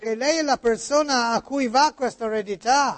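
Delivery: put-on Italian spoken with an American accent. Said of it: "come parla Niculo? ... oggi americani".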